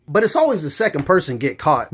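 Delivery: ordinary talk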